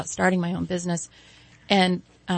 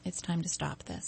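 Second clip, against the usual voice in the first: hushed late-night decision, softer and settled; quiet resolve; 10 dB quieter than usual. Retold or imitated. Husband in bed tells his wife it's time, quietly.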